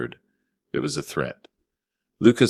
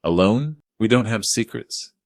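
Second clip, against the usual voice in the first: sharp; 7 semitones higher, 4 dB louder than usual. Acted. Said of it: "Jessica said sharply".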